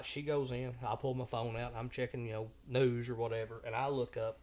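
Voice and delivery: ordinary delivery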